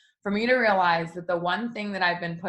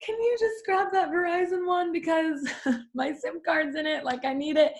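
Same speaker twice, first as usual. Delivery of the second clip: much higher, sweetly begging with a laugh; high pleading voice; 10 semitones higher than usual. Retold or imitated. Asking the clerk for her sim card box, high laughing plea. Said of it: "And I was like".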